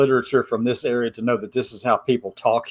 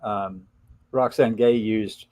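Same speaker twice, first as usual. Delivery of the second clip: normal talking voice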